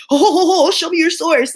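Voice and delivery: mock him as a smug keyboard warrior; mocking the arguer. Mocking the guy who demanded sources, loud and laughing. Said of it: "that's how I picture he, like, types".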